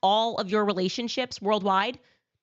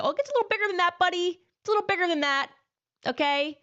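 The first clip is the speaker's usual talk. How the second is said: condescending scolding; 9 semitones higher, 4 dB louder, faster than usual. Acted. Scolding an imagined opponent.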